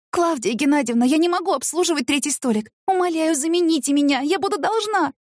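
high, desperate begging; pleading. Acted. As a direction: beg her for rescue. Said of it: "взмолилась Таисия".

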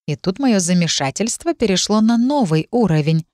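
ordinary delivery, nothing marked

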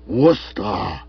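drawn-out snarled name; animal snarl. A narrator's reading. Stilton recalling his rival, snarling like an animal. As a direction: growl the name with loathing. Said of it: "emitting an animal snarl".

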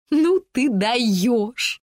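very slow, breathless laughing; gasping with laughter. A narrator's reading. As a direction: gasp it out through laughter. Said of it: "задыхалась от смеха Фрэнси".

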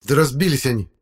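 put-on high-pitched boyish outburst; heated exclamation. A narrator's reading. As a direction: blurt it out with feeling. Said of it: "горячо воскликнул второй парень".

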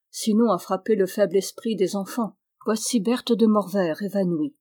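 ordinary delivery

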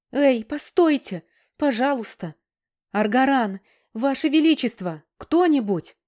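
loud, high cries for help; desperate shouting. Acted. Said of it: "кричала я".

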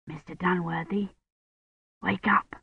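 hushed wake-up whisper. A narrator's reading. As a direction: whisper it softly into the dark. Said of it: "Colin whispered".

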